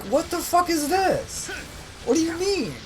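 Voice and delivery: high, stretched-out squeal of horror; shocked disbelief. Retold or imitated. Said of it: "And I was like".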